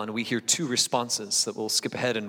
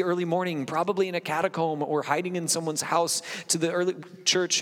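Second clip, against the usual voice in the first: high, raised, urgent preaching; impassioned preaching; 7 semitones higher than usual. Real, not acted. Pastor urging his church to wake from slumber, high and urgent. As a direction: lift the room to wake up. Preaching builds to full force.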